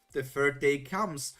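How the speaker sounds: plain reading voice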